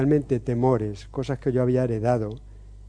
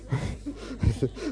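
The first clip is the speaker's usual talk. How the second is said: high laugh; 10 semitones higher, 7 dB quieter than usual. Real, not acted. Laughs at his old notes.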